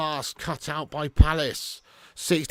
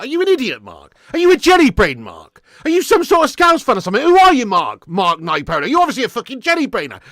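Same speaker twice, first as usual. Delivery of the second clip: raised, scornful telling-off; angry rant; 5 semitones higher, 7 dB louder than usual. Real, not acted. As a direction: tear into him for a stupid comment. Fan rants at a chat viewer.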